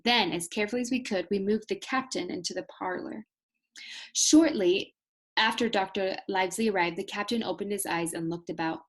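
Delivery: usual speaking voice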